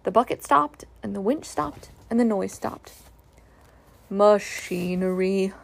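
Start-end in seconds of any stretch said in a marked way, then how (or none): 4.1-5.5 slow, low, glum Eeyore grumble; gloomy donkey mutter. A narrator's reading. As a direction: drag it out like a gloomy old donkey. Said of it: "muttered Eeyore".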